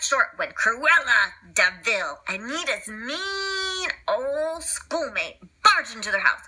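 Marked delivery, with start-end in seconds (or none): none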